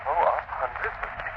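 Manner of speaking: ordinary talk, nothing marked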